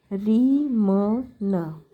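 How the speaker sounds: syllable by syllable, very slow; slow sounding out. Shown as a demonstration. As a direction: read out each syllable slowly for pupils. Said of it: "तो इसको पढ़ते हैं री". (धीरे-धीरे अक्षर-अक्षर पढ़ना)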